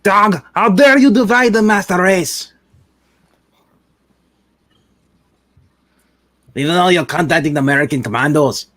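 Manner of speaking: loud, furious Nazi villain shouting; villain rant. Acted. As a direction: bellow threats at the prisoners. Nazi villain rants at captives.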